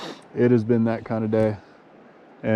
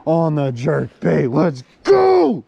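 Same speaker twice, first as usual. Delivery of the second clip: hyped up; 3 semitones higher, 6 dB louder, much slower than usual. Real, not acted. Angler cheering his jerk bait catch, hyped. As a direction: draw it out, then yell in triumph. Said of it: "I'm sorry I'm so hype".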